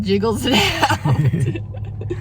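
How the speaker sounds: slow echo through laughter; laughing. Real, not acted. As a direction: stretch it out while laughing. Partner echoes it laughing.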